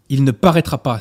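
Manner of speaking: a sharp outcry cutting the air; exclaiming. Acted. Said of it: "s'écria-t-il". (Lupin s'écrie, plus fort)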